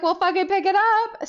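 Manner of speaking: high, loud urgent shout; frantic yelling. Retold or imitated. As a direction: yell it in excited panic. Reenacting frantic order to friend.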